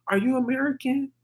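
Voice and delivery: soft, high, timid-sounding question; soft-spoken imitation. Scammer girl approaching a tourist, imitated soft high voice. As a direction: imitate a real soft little voice. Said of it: "She's, like, speaking real soft".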